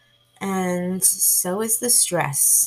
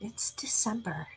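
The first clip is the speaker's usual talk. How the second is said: whisper; 6 dB quieter than usual. Real, not acted. Disbelief at warm December weather.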